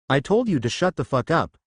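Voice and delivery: ordinary delivery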